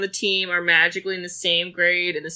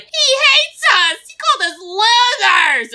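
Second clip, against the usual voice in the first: high, loud mock-child wail; shrill-pitched tantrum, mocking the kids; upset children; 6 dB louder than usual. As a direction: cry it out like a wounded kid, hammed up. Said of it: "the children hear it, and they're like".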